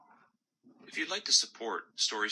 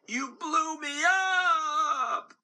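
much higher, slow, shrieking outrage; screaming gumball; 5 dB louder, much slower than usual. Acted. Gumball popped in a bubble, much higher slow scream. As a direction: scream it in disbelief. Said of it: "the gumball screamed".

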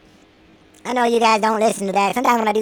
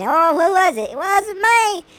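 high, lightly voiced kid; mock kid voice, high pitched; kid denying; 9 semitones higher, 4 dB louder than usual. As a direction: deny it like a guilty child. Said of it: "the kid saying".

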